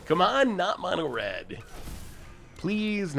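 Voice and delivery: ordinary talk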